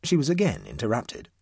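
plain reading voice

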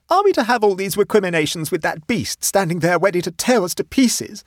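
high, loud distressed complaint; indignant wail. A narrator's reading. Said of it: "wailed Mavis indignantly".

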